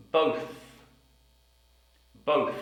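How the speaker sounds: Both ending in th; careful final th. Shown as a demonstration. Teacher drilling the word Both. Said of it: "We also have".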